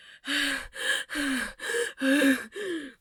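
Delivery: a long, mock out-of-breath exhale; heavy breathing sigh. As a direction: show how you faked being winded. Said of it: "like that".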